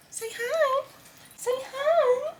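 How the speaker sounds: very high cooing voice for the dog. Real, not acted. Owner coaxing her dog to say hi, high baby talk.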